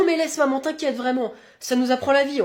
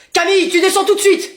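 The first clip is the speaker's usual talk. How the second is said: high, raised parental command; shouted order; 10 semitones higher, 4 dB louder than usual. Acted. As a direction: order the child down from afar. Parent orders child downstairs. (ordre crié, voix aiguë)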